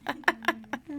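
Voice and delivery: high, feeble grr; badly faked growl. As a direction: try to growl, hopelessly unthreatening. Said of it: "I can't growl".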